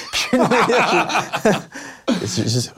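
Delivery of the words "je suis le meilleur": slogan crié aigu en riant. Retold or imitated. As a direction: clame son slogan, mort de rire. (son slogan, dit dans un éclat de rire)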